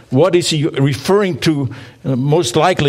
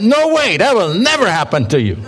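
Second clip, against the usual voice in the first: emphatic protest; 5 semitones higher than usual. Shown as a demonstration. Pastor retelling Peter's rebuke to Jesus, emphatic high protest. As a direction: throw it out in disbelief, refusing. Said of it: "I think he said".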